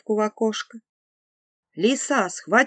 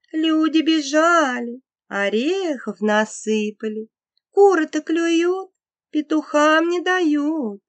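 sly fox voice; 7 semitones higher than usual. A narrator's reading. Fox tempts rooster.

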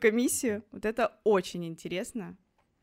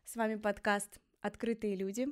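ordinary talk, nothing marked

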